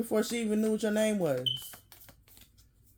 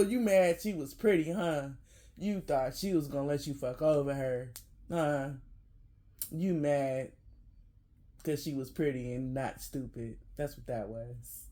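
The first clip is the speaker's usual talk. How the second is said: softer, lower mocking taunt, trailing away; 7 semitones lower, 7 dB quieter than usual. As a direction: tease him low and quiet, a gloating jab. Taunting the dumped guy.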